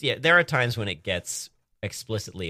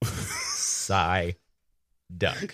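slow demonstration, Sigh and duck split apart; stress on SIGH. Shown as a demonstration. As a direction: Sigh drawn out, a pause, then duck. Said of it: "The emphasis is on the sigh".

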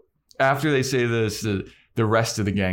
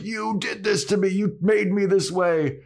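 accusing troll; 11 semitones higher than usual. Acted. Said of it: "the troll is like".

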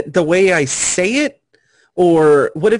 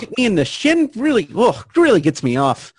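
higher, sarcastic stand-up comic rant; Bill Burr; 8 semitones higher than usual. Acted. Riffing on the bit, a comic's sarcastic rant pitched higher. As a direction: riff it like a Boston comic on stage. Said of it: "my immediate voice was Bill Burr".

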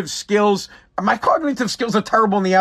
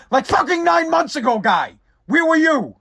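exasperated shout; 8 semitones higher than usual. Retold or imitated. Retorting to a clueless tweet.

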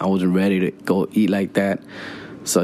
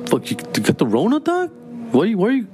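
high, fast panicky questions; panicked backing off; 11 semitones higher, faster than usual. Retold or imitated. Brother-in-law backs away from the narrator in mock panic. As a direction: recoil from the sick guy.

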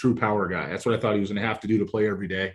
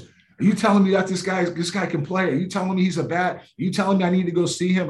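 incredulous boss; 7 semitones higher than usual. Retold or imitated. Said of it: "He goes".

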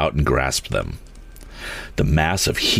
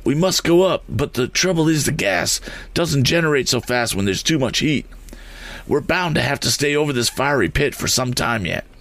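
higher, strained voice; worried balloonist; 8 semitones higher than usual. A narrator's reading. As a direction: explain the danger, tense and strained. Sharp worried over fire.

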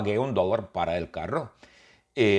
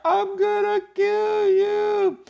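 very high, drawn-out spooky wail; eerie falsetto ghost moan; 4 dB louder, much slower than usual. Acted. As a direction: wail it slowly like a haunting ghost. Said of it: "Es la voz de la fantasma".

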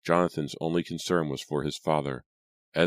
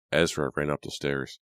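plain reading voice

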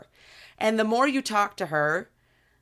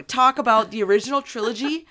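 ordinary delivery

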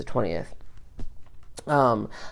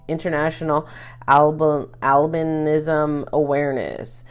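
ordinary delivery

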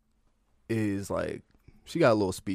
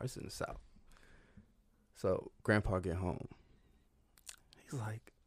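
ordinary talk

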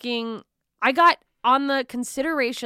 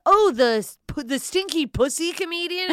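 raised, mocking voice for a cliché; mock label voice; 8 semitones higher, 4 dB louder than usual. Comic mocking the label she dreads, mock voice. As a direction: sneer out the label in a put-on voice. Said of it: "I don't ever wanna be the".

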